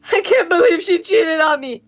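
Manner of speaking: high, slow whining sob; mock crying. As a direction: imitate a heartbroken friend's wail. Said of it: "He tells me this crying".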